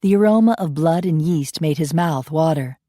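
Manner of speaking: plain reading voice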